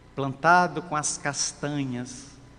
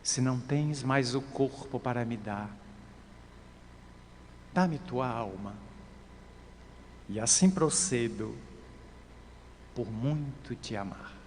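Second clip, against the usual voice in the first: low, very soft loving answer; tender; 5 semitones lower, 11 dB quieter than usual. A narrator's reading. Jesus to the leprous woman out of love, tenderly. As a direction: lower the voice and soothe tenderly.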